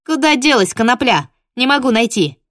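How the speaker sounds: calling out. Retold or imitated. Shouting for the lost dog.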